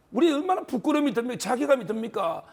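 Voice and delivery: ordinary talk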